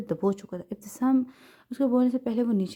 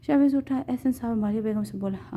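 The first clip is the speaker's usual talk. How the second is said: ordinary delivery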